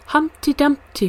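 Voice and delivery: put-on high, loud child's shout; out-shout the others with your pick; eager shout. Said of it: "cried others".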